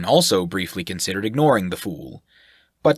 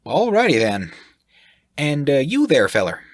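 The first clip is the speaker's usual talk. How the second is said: higher and louder, nosy and blunt; brash sailor voice; 7 semitones higher, 5 dB louder than usual. Acted. The sailor asks the group who they are, brash and loud. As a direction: fire questions like a brash sailor. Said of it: "continued on awkwardly".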